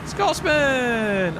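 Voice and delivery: high, loud cheer of the name; excited shout. Real, not acted. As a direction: hail the raider as he arrives. Greeting an arriving raid.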